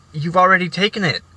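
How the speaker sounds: insist, fed up; exasperated protest. Retold or imitated. Student protesting to teacher who forgot, exasperated and loud.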